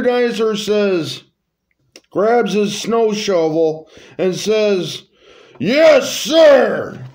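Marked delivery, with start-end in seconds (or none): 5.6-7.0 high, loud yes-sir shout; loud, eager soldier reply. Acted. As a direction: snap to attention and shout it. Roger eagerly answers the king.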